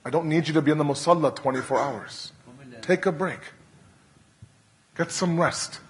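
soft and low, lovingly releasing him from duty; hushed, tender reassurance as if to a weary son; tender divine voice. Acted. Allah speaks tenderly to Hussein.

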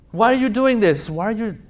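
loud, high demanding why; rhetorical challenge. Pretend. Preacher confronting the crowd over their excuses. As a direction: challenge the listener hard.

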